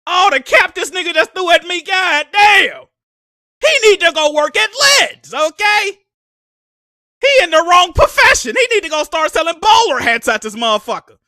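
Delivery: loud mocking rant. Real, not acted. Mocks Sony boss's lies.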